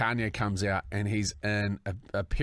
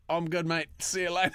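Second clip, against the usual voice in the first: high-pitched, cracking up on the punchline; higher voice, tipping into a laugh; 7 semitones higher than usual. Real, not acted. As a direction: barely hold back real laughter. Retelling punchline, breaking into laughter.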